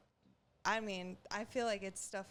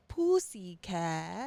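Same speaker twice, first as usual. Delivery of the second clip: imitating a toddler, louder and drawn out slowly with a rising end; kid repeating a word; 7 dB louder, much slower than usual. Mom imitating her son in the car, a slow, loud childish sing-song. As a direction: stretch it out like a kid who thinks he's hilarious. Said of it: "he was just in the car just going".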